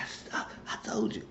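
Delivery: mock out-of-breath whisper; pissed-off, voiceless huff; huffing and puffing. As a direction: imitate an angry man gasping out his words. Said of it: "David was huffing and puffing and pissed".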